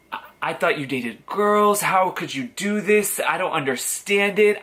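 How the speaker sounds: retold upset parents. Parents react to coming out.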